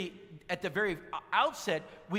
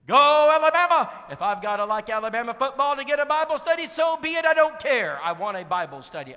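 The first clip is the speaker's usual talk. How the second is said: preacher loudly taking up Perry's Alabama cheer; fan cheer; 7 semitones higher, 13 dB louder than usual. Retold or imitated. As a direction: shout it like a converted fan.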